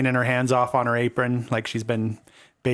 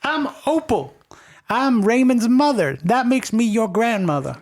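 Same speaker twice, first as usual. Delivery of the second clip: grandma Opal; 9 semitones higher than usual. Acted. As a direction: introduce yourself warmly as grandma. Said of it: "who are you?".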